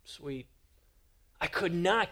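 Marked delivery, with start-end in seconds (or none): 0.0-0.4 soft, flat brush-off; dismissive mumble. Retold or imitated. Son brushing off his father on the phone, soft and dismissive. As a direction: trail off, avoiding the moment. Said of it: "I'm like".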